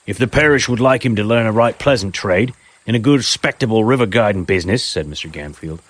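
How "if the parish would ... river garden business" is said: raised, loud rustic tradesman voice; a pushy chimney-sweep type selling himself; rough tradesman. Acted. Gamfield pitches his trade.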